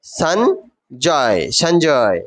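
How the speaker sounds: Sanjay drawn out very slowly; the teacher says the name slowly to the class; slow name model. Shown as a demonstration. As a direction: stretch out Sanjay so pupils can copy it. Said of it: "কে বলতেছে?".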